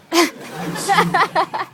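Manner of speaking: hammed-up high loud villain cry, slow; mock serial killer. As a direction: shriek it like a cartoon maniac, slow. Comic mock serial killer declaration.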